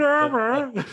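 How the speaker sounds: very high, slow put-on voice; Cheeks voice. Stuck on the top rope, Cheeks calling out in a very high slow voice. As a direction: stretch it out way up high like a big helpless guy. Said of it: "a fat voice".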